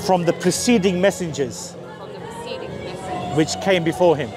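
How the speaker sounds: slow English, long pause after each phrase; very slow recitation. Shown as a demonstration. Preacher leads a new convert through the testimony, very slow. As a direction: recite phrase by phrase, slowly, so he can follow. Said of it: "I'm gonna do it real, real slow".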